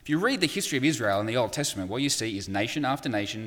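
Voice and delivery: normal talking voice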